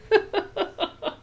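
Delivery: high-pitched run of giggles; real laugh. Real, not acted. Quilter realizing she sewed it on wrong, high real laugh. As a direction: laugh at your own blunder. Laughs at her own sewing mistake.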